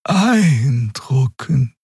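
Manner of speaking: very slow and weary; awed but worn out, very slow; tired voice. A narrator's reading. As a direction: let the word drag out of her tiredly. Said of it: "ihre Stimme klang müde wie immer".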